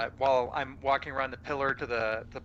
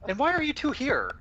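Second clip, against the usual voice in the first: incredulous; 7 semitones higher than usual. Acted. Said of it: "I, I say incredulously".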